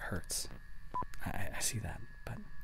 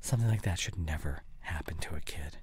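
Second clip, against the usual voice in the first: hushed, gentle and tender; gentle whispered sympathy; soft comfort; 4 semitones lower, 9 dB quieter than usual. Acted. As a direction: soothe her through painful memories. Comforting her about her past.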